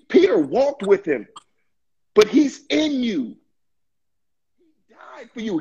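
ordinary talk, nothing marked

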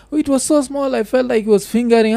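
high mocking woman's voice; quoting her. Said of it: "vile alisema".

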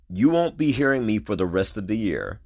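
ordinary talk, nothing marked

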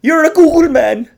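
high, loud mock rant at interns; boss scolding interns. Gaffer mocking clueless interns, high loud rant. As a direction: belt it out like a ranting gaffer. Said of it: "He'd be like".

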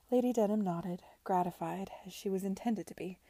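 ordinary delivery, nothing marked

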